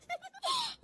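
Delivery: soft, high falling titter; sly little high laugh; gloating giggle. Acted. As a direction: snigger with self-satisfaction. Gloating at the mirror.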